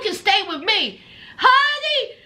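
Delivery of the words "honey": usual speaking voice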